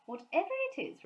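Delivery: a hint of a cheerful mum; Mrs Brown. A narrator's reading. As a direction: answer brightly. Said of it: "replied Missus Brown".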